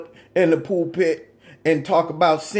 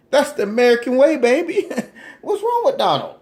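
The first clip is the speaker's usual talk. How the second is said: laughing through the punchline; 8 semitones higher than usual. Real, not acted. Comedian cracking up at his own sarcastic joke. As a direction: let the laugh break through the words.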